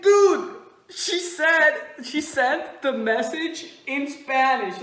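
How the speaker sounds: high, loud, emphatic protest; exasperated retort. Retold or imitated. Teller arguing back to a friend, exasperated. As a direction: drive the point home.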